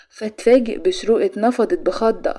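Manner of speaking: ordinary delivery, nothing marked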